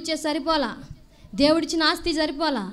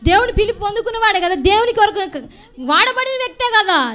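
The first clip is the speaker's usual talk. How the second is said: higher and louder, each question pressing harder; preaching at full force; 5 semitones higher, 5 dB louder than usual. Real, not acted. Preacher pressing the congregation, rising loud rebuke. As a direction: rebuke them loudly and keep climbing.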